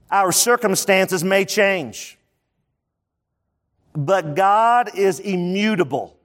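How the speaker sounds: slow and spaced out for note-taking; slow dictation. Shown as a demonstration. Pastor dictating a key point to the congregation, slow and spaced. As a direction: give them time to write each phrase. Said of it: "I want you to write this down".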